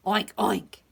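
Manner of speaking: two loud oinks; pig oinks. A narrator's reading. Pig answering the hen, oinking. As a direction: oink like a storybook pig. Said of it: "said the pig".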